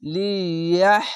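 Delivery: slow drawn-out recitation of লিয়াহ; Quranic recitation. Shown as a demonstration. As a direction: recite লিয়াহ slowly with a breathy final হ. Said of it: "এই হায়ের সঙ্গে মিলবে". (ধীরে টেনে তিলাওয়াত)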